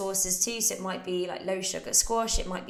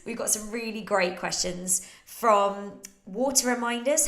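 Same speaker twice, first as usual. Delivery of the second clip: ordinary talk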